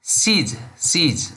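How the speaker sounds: "sees" as one syllable; "sees", repeated as a single joined word; contracted "she is". Shown as a demonstration. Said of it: "we say".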